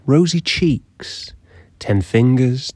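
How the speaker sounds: hushed storyteller's whisper. Acted. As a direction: drop to a whisper for these details. Whispered details in mock legend.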